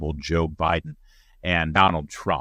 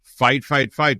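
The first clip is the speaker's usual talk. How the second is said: louder, higher chant of fight fight; echoed rally cry; 8 semitones higher, 5 dB louder, slower than usual. Retold or imitated. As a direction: punch it like a crowd chant. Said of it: "rallying cry".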